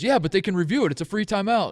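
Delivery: lightly acted urging of the coach; imitated insistent staffer. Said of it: "And he goes".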